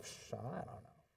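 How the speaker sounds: low, quiet deflated dismissal; acted-out letdown, soft and low; unimpressed reader. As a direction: lose all interest, dropping the voice. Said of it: "and we're like".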